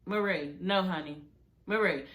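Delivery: gently begging her to stop; soft plea. Real, not acted. Telling Marie off through the screen, low and soft. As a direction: drop low and soft, shaking your head.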